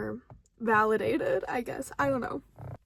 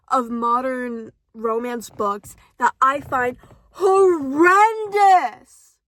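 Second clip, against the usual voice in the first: disgusted drawl; 8 dB louder, slower than usual. Real, not acted. Ranting disgust.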